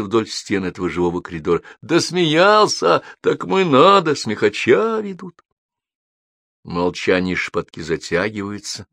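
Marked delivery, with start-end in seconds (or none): 1.8-5.4 high, drawn-out onlooker cries; crowd calling out. A narrator's reading. Onlookers along the road comment on the joker, calling out. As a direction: call out over the crowd.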